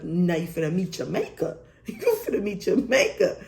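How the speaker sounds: mock threat breaking into laughter; half-joking threat, laughing as she says it. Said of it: "I'm thinking to myself".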